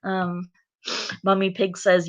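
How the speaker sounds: ordinary delivery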